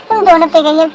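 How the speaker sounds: usual speaking voice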